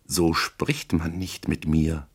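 slow, soft, husky indignation; hoarse. A narrator's reading. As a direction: warn him hoarsely, under your breath. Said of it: "sagte sie heiser".

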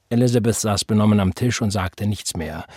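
plain reading voice

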